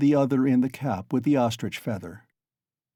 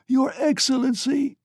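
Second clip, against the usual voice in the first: high wail; heart-rending wail with tears; 3 dB louder than usual. A narrator's reading. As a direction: wail it out suddenly. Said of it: "she wailed suddenly".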